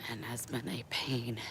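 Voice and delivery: whispered aside; whispering. Real, not acted. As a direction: breathe it out under your breath. Aside about the pool.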